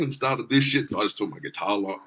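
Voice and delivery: normal talking voice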